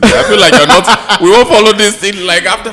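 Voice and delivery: loud, high-pitched amusement breaking into laughter; laughing. Real, not acted. Teasing friends about their frank talk, laughing.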